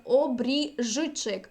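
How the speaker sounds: обріжчик very slow, voiced ж before ч. Shown as a demonstration. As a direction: slowly model обріжчик in two parts, keeping ж. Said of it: "«ж ч»". (дуже повільно обріжчик, з дзвінким ж)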